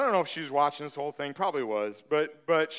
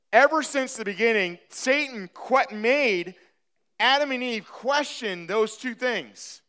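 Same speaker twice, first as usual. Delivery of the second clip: higher, louder, urgent sermon voice; preaching at full force; 10 semitones higher, 5 dB louder than usual. Acted. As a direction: drive the point home to the congregation.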